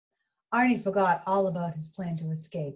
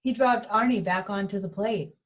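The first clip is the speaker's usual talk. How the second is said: usual speaking voice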